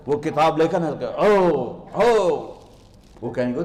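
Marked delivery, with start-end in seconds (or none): 1.1-2.4 loud happy cry; loud cheer of joy; joyful shout. Acted. Cheer of the blessed.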